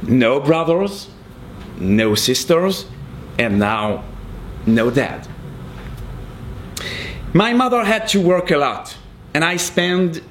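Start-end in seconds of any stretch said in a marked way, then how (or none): none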